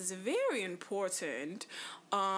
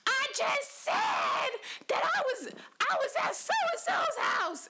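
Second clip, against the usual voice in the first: very high, screechy mock yell; imitating yelling relative; 3 dB louder than usual. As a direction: act out exasperated yelling, pitched way up. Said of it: "you don't have to yell".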